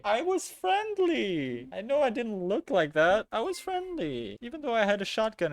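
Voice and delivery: whiny protest. Real, not acted. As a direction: whine in mock hurt. Whining after being attacked.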